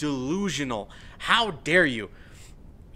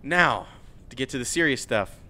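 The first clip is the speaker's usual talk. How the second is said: ordinary talk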